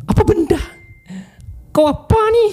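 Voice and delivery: high-pitched shocked yelp, retold; startled outcry. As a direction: act out a woman startled mid-bath. Startled bathing woman's outcry.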